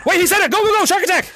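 normal talking voice

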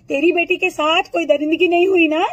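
ordinary talk, nothing marked

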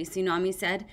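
ordinary delivery, nothing marked

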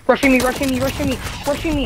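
high, loud urgent callout. Real, not acted. Callout under attack.

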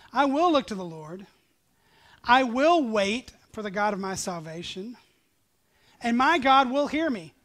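loud, rising proclamation; fervent scripture reading. Acted. Preacher voicing the prophet's confidence, loud and fervent. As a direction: declare each line with growing conviction.

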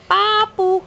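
put-on high sing-song word for baby; cooing first-word prompt; high coaxing prompt. Shown as a demonstration.

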